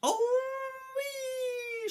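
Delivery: very high delighted shriek; gleeful crone scream. Acted. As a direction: squeal like a granny on a ride. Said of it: "she screamed".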